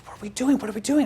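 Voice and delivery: high, panicky questioning; mock freaking out. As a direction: imitate a date freaking out. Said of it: "she's freaking out".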